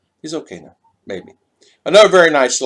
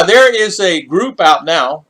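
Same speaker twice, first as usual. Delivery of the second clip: ordinary talk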